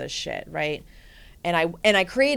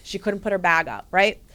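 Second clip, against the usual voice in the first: ordinary talk, nothing marked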